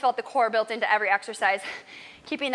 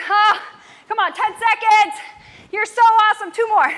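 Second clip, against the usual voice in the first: shouting encouragement; 9 semitones higher, 8 dB louder than usual. Real, not acted. Coach pushing the final seconds.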